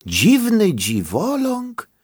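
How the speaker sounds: high, slow puzzled grandpa; grandpa's slow high surprise; surprised question. A narrator's reading. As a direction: wonder aloud, drawn out. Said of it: "zdziwił się dziadziuś Edzio".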